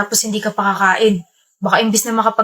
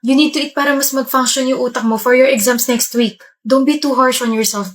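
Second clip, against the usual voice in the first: normal talking voice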